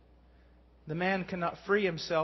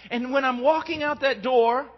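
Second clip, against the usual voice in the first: louder, higher preaching voice pressing the point; fervent preaching; 6 semitones higher, 5 dB louder than usual. Acted. Preacher pressing the new creature point to the congregation, fervent preaching. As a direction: drive the truth home to the congregation.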